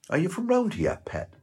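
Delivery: checkout lady. Retold or imitated. Checkout lady asking Barry where he's from, high chatty Geordie voice.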